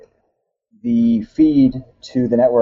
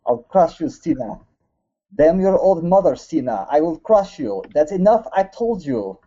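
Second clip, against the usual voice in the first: hammed-up fake Russian accent, pitched higher; 5 semitones higher than usual. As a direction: growl the threats like a sore-loser Russian wrestler. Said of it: "Do the old mother part".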